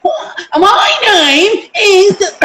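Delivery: high, very slow, laughing put-on posh voice; mock a child faking a foreign accent; mock affected accent. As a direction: parody of a child showing off a borrowed accent.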